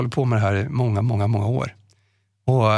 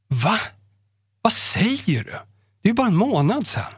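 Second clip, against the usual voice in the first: disbelief; 8 semitones higher than usual. Retold or imitated. Host in disbelief at the death.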